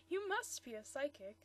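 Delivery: usual speaking voice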